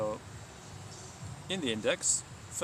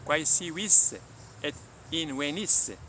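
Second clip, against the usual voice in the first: louder, raised declaration; solemn proclamation; 5 semitones higher, 5 dB louder, slower than usual. Acted. Siddhartha calling on the earth before Mara, ringing solemn declaration. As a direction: call the earth to witness. Buddha calls earth as witness.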